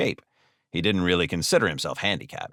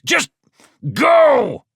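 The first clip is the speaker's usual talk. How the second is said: explosive high-pitched shout; loud, exasperated yell with a break between words; fuming; 8 dB louder than usual. Acted. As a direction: burst out with the order, furious. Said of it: "Carl fumed".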